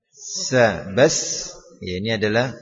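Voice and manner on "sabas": sabas with a sharp hissing s. Shown as a demonstration. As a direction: stretch the s into a hiss. (desis huruf sin)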